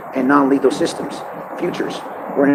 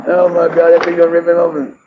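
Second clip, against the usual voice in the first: loud, high appalled reaction; loud horrified disbelief; shocked; 4 semitones higher, 4 dB louder than usual. Real, not acted. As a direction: gasp it out at the screen.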